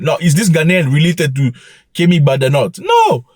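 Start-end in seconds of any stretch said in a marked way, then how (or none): 2.8-3.2 emphatic no. Real, not acted. Scoffing at his own question.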